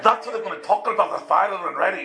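high, quick imitated Scottish brogue; hammed-up Scottish accent; thick Scottish accent. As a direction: rattle it off as a comic Scotsman. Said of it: "he's got a deep Scottish accent".

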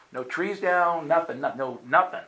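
ordinary talk

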